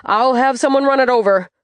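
high, loud parting shout. Acted. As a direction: shout it at her back. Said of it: "the assistant manager shouts after me".

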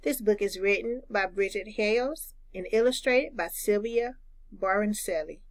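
ordinary delivery, nothing marked

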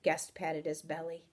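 ordinary delivery

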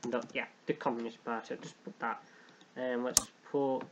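usual speaking voice

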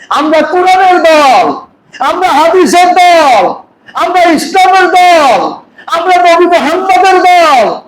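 high, rising preacher's chant; chanting slogans at full force; fiery preaching. Acted.

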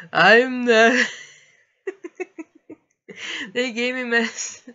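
giddy and woozy, can't finish the thought; giggly drugged voice. Retold or imitated. Texting boyfriend while drugged, giggling and loud.

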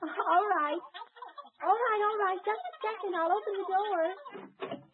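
a hint of a gentle young woman calling through a door; lightly voiced young lady. Young lady calling to the elf from behind the door, gentle and lightly voiced. Mary Holiday answers the door.

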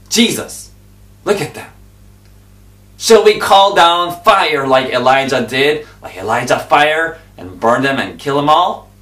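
eager disciples. Retold or imitated. Disciples voiced in the story.

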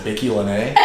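"Bicky Lene" said with bike as two syllables BI-kee and lane as LEEN; deliberate mispronunciation. Shown as a demonstration. Joking to friends, saying bike lane as "Bicky Lene" on purpose. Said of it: "mispronounce things on purpose".

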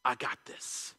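whispered, low reassurance; hushed and intimate, with a long pause; God's quiet assurance. Retold or imitated. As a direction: breathe it out softly as God's voice in the heart. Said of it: "God is saying".

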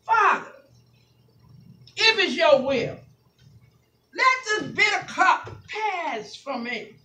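loud, chanted, half-sung sermon climax, drawn out slow; sing-preach the close, stretching the words; whooping preacher. Acted. Pastor whooping to church over organ, loud and sung.

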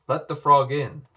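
loud commanding order; a king ordering the frog let in; stern bellow. A narrator's reading. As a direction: boom the command. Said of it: "bellowed the king".

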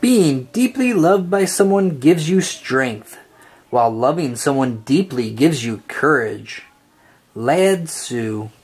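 ordinary delivery

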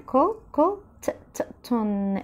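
كوتون split into كو and تون, with the ت said twice; syllable by syllable. Shown as a demonstration. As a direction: spell out كوتون in slow broken syllables. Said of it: "ال T هنا نطقتها مرتين". (تقطيع كوتون ببطء مقطع مقطع)

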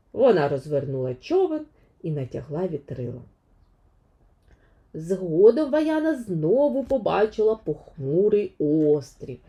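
plain reading voice

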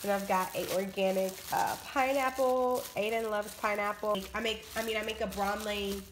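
ordinary talk

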